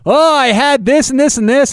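high, mocking TV bettor bragging; mocking gambling pundit. Host mocking gambling-show pundits in a high, bragging voice. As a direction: sneer at a pundit's hindsight brag. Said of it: "people on these gambling shows go".

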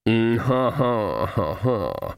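slow, spaced-out ha-ha; put-on laugh. A narrator's reading. Card sharper humours Granny, laughing falsely.

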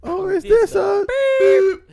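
high, slow mock outrage; theatrical mock disbelief; imitating the video. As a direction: stretch it out high and loud, hammed up. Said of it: "'cause it's like".

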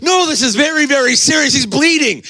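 put-on high-pitched, frantic kid protesting; earnest protest. Acts himself as indignant child to dad.

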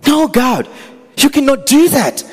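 high, loud "No, God" cried out; impassioned refusal. Acted. Moses crying out to God to spare the people, high and loud. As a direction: plead hard, pushing back at God.

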